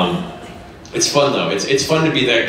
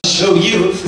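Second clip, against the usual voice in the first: villain voice; 9 semitones higher, 4 dB louder than usual. Shown as a demonstration. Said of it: "That's more the Kafka laugh".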